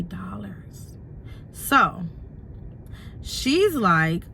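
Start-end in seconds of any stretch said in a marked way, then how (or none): 0.0-1.0 whispered under her breath; whispered aside. Real, not acted. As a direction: breathe the word out. Whispered end of the math.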